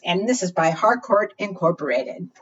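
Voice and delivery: ordinary delivery, nothing marked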